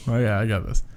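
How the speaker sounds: ordinary talk, nothing marked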